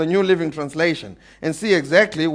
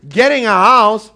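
loud emphatic; 4 semitones higher, 7 dB louder than usual. Real, not acted. Preacher building emphasis.

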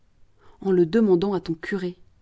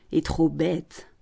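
sneering; 3 semitones lower, much slower than usual. Acted. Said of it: "Le promoteur ricane".

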